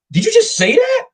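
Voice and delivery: gasping, high-pitched disbelief; mock shock. Women reacting to his bluntness, mock shocked. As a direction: squeal it like a shocked woman. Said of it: "like, a shock look".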